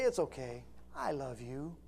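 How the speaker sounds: soft, low, soothing preacher voice; mock a gentle, reassuring preacher, hushed; Joel Osteen. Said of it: "like Joel Osteen preaches".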